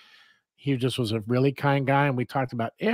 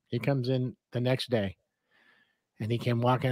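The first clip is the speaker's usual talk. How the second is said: normal talking voice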